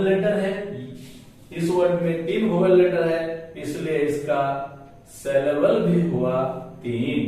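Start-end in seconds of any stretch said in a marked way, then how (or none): none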